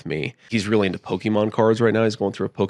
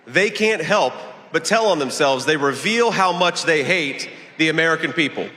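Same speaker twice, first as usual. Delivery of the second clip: high, projected stump-speech delivery; rally speech; 9 semitones higher than usual. Real, not acted. Candidate attacks the opposing party before a crowd, rally speech. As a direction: address the crowd in measured, emphatic phrases.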